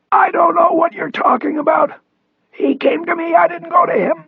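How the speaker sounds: strained, high whispery rasp; raspy voice. A narrator's reading. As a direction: push the protest out through a rasp. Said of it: "Cross rasped".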